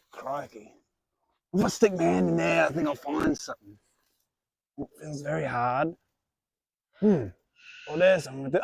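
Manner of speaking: broad put-on Australian accent, hearty host; jolly Australian reptile presenter; Australian wildlife host. Plays a crocodile-hunter style host.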